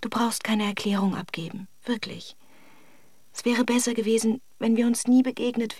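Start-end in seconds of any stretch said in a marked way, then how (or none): 0.0-2.4 gentle whisper that hushes him; woman whispering to stop her lover's words; hushing whisper. Acted. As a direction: shush him softly.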